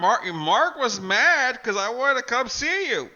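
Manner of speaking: indignant outburst. Retold or imitated. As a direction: exclaim it in disbelief. Indignant retelling, high and loud.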